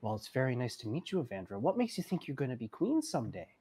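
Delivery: put-on soft, gentle voice for a child; kindly, hushed questioning; Arthur. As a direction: speak quietly down to a little one. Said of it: "Arthur kind of squats down and goes".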